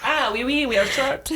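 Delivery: hammed-up French accent through laughter; high, playful fake French accent; mock French accent. Mocking the short girl at the concert, French accent. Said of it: "in her French accent".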